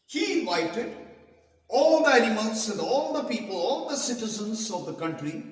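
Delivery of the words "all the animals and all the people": plain reading voice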